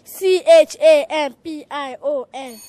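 letters shouted out loud. Acted. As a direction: belt every letter. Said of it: "Say it louder".

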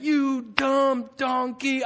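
imitated scolding, very slow and high; syllable-by-syllable scolding. Balaam whipping his donkey, mock word-by-word scolding. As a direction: land each word as a separate blow. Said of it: "it was one of those".

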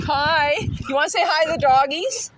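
a light, playful celebrity-style impression, not overdone. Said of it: "Rich Little or whatever".

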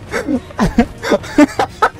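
breathless, soft high laughing fit; real helpless laugh; wheezing laughter. Real, not acted. Laughing at crying child.

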